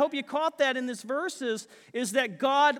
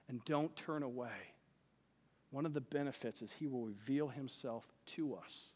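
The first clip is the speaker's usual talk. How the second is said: usual speaking voice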